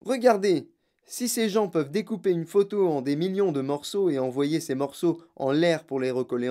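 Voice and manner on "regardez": raised, higher call; retold shout of discovery; excited cry. A narrator's reading. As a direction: cry out to grab their attention. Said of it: "J'ai crié".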